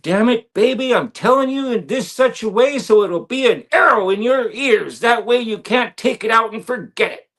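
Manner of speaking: high, loud, sharp scolding; a mother shouting a lesson she wants remembered; mother scolding sharply. Retold or imitated. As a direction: raise your voice at your child to make the point stick. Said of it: "she'd raise her voice and be sharp with me".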